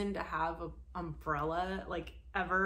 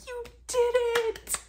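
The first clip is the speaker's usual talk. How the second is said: much higher, louder hammed-up cheer; mock cheering; 10 dB louder, much slower than usual. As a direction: cheer like a silly fan. Mocking fans cheering.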